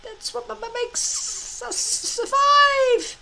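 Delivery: high, slow, stuttering dragon voice; a small dragon arguing with itself, tripping on the words; squeaky stutter, talking himself into it; stammering little dragon. A narrator's reading. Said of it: "argued Toothless to himself".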